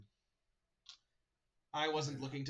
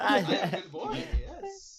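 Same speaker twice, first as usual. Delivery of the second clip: high, slow, laughing through the words; laughing dog voice; 5 semitones higher, 7 dB louder, much slower than usual. Acted. GM repeats the dog's line, laughing.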